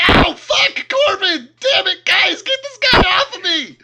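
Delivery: very high, full-volume cry for help; frantic wailing plea to friends; pained screaming. Acted. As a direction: yelp in pain, then scream for rescue. Punched captive yells for help.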